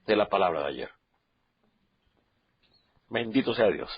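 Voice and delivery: soft, low, touched remark; quiet moved aside. Real, not acted. Pastor softly tells church he is still moved. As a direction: hush down, moved by yesterday's word.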